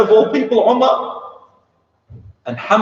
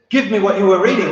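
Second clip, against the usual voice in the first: angry demand; 5 semitones higher than usual. Retold or imitated. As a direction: bark the order at his sister. Angry brother demands the page.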